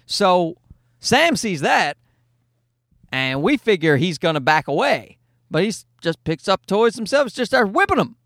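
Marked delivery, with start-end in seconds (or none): none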